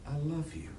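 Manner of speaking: very soft "I love you", "love" stressed and falling; nearly breathed, "love" leaned on; hushed, love stressed. Shown as a demonstration. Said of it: "It can be".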